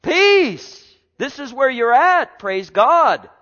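high, loud proclamation; exultant preaching. Real, not acted. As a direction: cry it out to the congregation.

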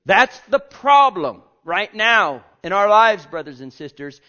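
loud, rising preacher's cry; full-force preaching. Acted. Preacher to his congregation, loud fervent proclaiming.

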